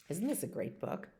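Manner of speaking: ordinary delivery, nothing marked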